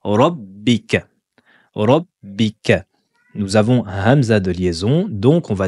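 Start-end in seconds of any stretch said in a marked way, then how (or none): 0.0-2.9 slow Rabbika with the doubled b held. Shown as a demonstration. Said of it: "La lettre B est dédoublée".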